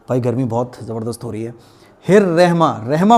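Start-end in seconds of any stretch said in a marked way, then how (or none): 2.0-3.2 रहमा as a light 'rehma'; wrong pronunciation. Shown as a demonstration. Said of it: "पढ़ते हैं". (रहमा को 'रेहमा' बोलकर)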